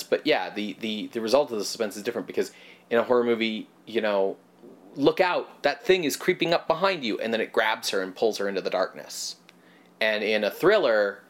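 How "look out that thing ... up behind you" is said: higher, urgent viewer's warning; lightly acted alarm, pitched up; mock warning. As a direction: warn the character on screen. Voices a horror movie viewer's warning.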